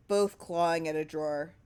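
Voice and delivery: plain reading voice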